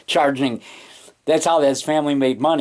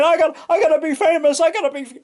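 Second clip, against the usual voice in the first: high pleading mock voice, pitched well up; lightly hammed desperate dreamer; mock fame-hungry wannabe; 13 semitones higher, faster than usual. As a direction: act out a frantic wannabe begging to be famous. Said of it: "put unusual stress on yourself saying".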